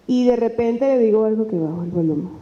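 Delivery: ordinary talk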